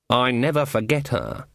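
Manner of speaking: plain reading voice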